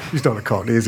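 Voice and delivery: ordinary delivery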